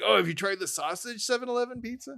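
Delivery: high mocking fan voice; lightly voiced eager fan. Mocking a fan who writes in, eager fan voice.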